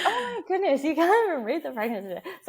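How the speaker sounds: high, laughing mock disbelief; laughing tease. Wife teasing husband who can't read the test, laughing. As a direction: tease him through laughter.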